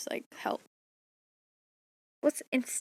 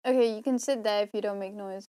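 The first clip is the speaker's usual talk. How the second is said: usual speaking voice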